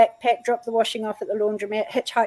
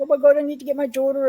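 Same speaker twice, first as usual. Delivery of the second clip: higher, hurried distressed plea; retold crying; 5 semitones higher, 4 dB louder, faster than usual. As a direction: blurt it out as if tearful, re-enacted. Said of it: "I was crying".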